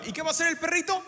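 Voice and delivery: ordinary talk, nothing marked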